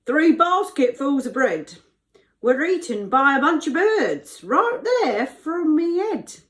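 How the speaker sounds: high, loud character voice with dropped h; baker voice. Acted. Baker recounts birds eating bread, broad loud character voice. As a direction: play a broad, noisy baker. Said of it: "said the baker".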